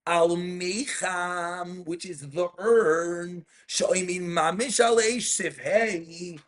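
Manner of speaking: slow, deliberate reading of the text. Shown as a demonstration. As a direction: spell it out slowly for the listener. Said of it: "Let me read this a little slower".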